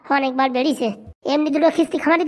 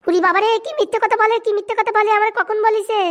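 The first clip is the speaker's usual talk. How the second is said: higher, whining wife protesting; whiny wife; 6 semitones higher than usual. Acted. Wife complaining to her husband about Phuto, high whine. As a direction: play the wife, aggrieved and shrill-pitched high.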